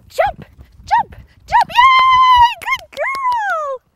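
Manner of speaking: high, loud jump cues to a shouted yay; excited jump cheer. Real, not acted. Trainer cheering Sally over the jumps, high and loud.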